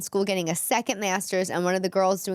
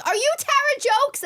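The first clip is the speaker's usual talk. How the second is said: high excited scream across the street; 4 dB louder than usual. Retold or imitated. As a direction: shriek the question at a stranger. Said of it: "some woman screamed".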